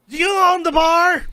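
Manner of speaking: rough voice. Acted. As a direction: bark it like a gruff little man. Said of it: "in a rough voice".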